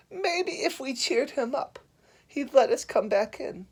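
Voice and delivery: high, slow cartoon zebra. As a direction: coax the others as a high-voiced zebra.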